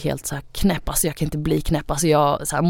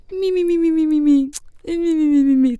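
high, whiny "mi mi mi" taunt; mocking imitation; 11 semitones higher than usual. The boyfriend mocking her crying with a high whiny "mi mi mi". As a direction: mimic her crying like a brat. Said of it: "då härmar han ju mig och bara".

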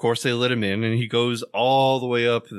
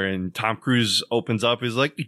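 ordinary talk

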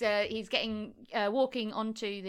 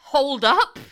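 mock outraged mum; 3 semitones higher, 8 dB louder than usual. Voices the mum objecting, mock.

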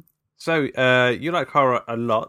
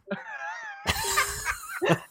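shrieking laugh; 4 dB quieter than usual. Real, not acted. Host bursts out laughing.

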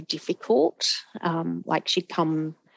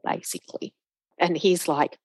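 ordinary delivery

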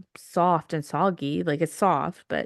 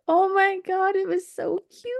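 high gushing over cuteness; 12 semitones higher, 3 dB louder than usual. Real, not acted. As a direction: squeal it's so cute. Picturing dog in cast.

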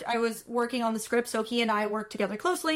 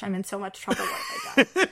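laughing through mock panic, last words much higher; 8 semitones higher, faster than usual. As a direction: giggle out the worry as you replay it. Retold panic at being pulled aside.

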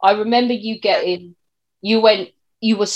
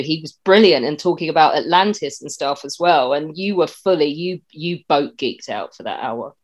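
ordinary talk, nothing marked

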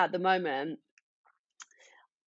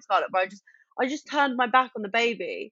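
ordinary talk, nothing marked

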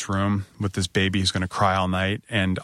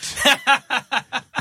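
high helpless laugh; embarrassed laugh. Real, not acted. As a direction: laugh it off sheepishly. Laughing after his own rudeness.

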